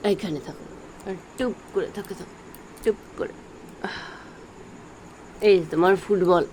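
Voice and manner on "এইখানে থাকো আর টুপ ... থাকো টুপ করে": whispering. Real, not acted. Soothing pet to stay still.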